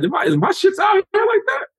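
high shouted disbelief; panicked self-doubt. Retold or imitated. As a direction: yell it high, horrified at your own teeth.

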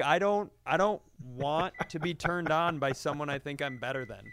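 normal talking voice

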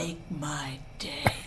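low, slow, hushed tough-guy line; play-threaten under the breath, drawn out; quiet mock menace.

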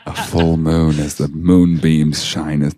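mock-poetic narration. Co-host narrating the shirtless doctor at the window, mock-poetic. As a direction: savour it like overblown moonlit prose.

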